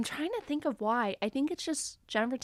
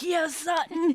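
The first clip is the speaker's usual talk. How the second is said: higher-pitched cheer from the couch; mock cheering; 7 semitones higher, 4 dB louder, slower than usual. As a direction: egg her on like a fan. Acting out viewers cheering at home.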